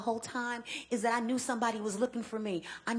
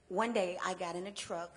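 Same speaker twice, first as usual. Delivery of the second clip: normal talking voice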